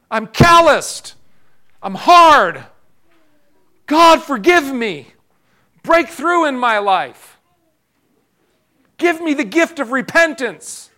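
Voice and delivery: a desperate prayer cried out high and loud; pleading repentance prayer, shouted high and loud. Acted. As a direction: cry it out to God as if begging. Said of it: "get on your knees and say".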